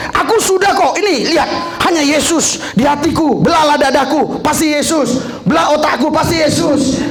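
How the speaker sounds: mimicking a boastful believer; mock proud boast. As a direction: act out a boastful churchgoer, shouting. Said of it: "mungkin Anda berpikir".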